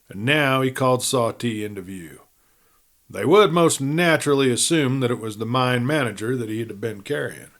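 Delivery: plain reading voice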